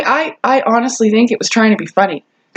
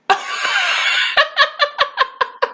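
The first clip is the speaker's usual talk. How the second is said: shrieking laugh up high; high squealing laugh; 6 dB quieter than usual. Real, not acted. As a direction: burst into a high breathy laugh. Big laugh at the punchline.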